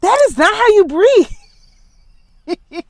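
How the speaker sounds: high, loud and slow, not how you breathe; exasperated retort, stretched out; indignant outburst. Retold or imitated. Retorting to the evangelist, high and loud outrage. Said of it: "And I was like".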